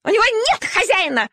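very high, fast, shrill outcry; piercing squeal. Acted. Little dog shrieking to the others about the ownerless puppy. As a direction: shriek it as a scandal. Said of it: "пронзительно взвизгнула Шайба".

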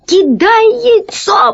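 very high, strained croak; croaked shout. A narrator's reading. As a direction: squeeze out a desperate, choked order. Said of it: "прохрипел весельчак У". (сдавленный отчаянный хрип)

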